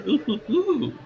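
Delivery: very high mock "ooh-hoo-hoo"; Larry's hooting. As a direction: imitate a high, suggestive hoot. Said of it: "He, like, gets in there".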